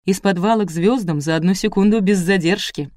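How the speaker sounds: plain reading voice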